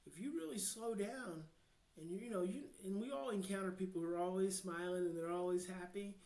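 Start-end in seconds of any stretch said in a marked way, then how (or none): none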